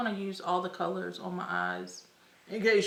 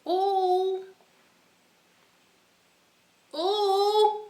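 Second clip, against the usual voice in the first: excited ooh; 11 semitones higher, 5 dB louder than usual. Real, not acted. Reacting to the highlighter shade.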